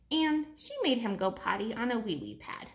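ordinary delivery, nothing marked

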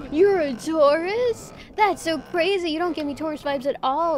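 high, loud, stretched-out gasp of surprise; loud gossip-girl astonishment; shocked disbelief. Retold or imitated. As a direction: act floored by the news. Coworker shocked by zodiac sign.

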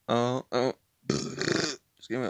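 normal talking voice

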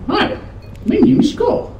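high squeaky puppet voice; ventriloquist voice. Pretend. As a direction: make the skull talk in a tiny high voice. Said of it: "Are you a ventriloquist now".

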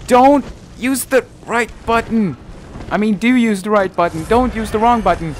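high, loud frantic orders; panicked self-scolding. Real, not acted. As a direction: yell at yourself mid-fight. Panics over controls.

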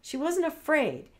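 usual speaking voice

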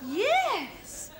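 usual speaking voice

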